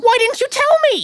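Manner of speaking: astonished outburst. Acted. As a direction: blurt it out in shock. Shocked at neighbour news.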